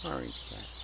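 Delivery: low whisper to not scare the cat. Real, not acted. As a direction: breathe it out unvoiced. Whispering about hidden cat.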